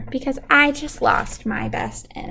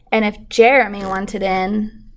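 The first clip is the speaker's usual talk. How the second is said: plain reading voice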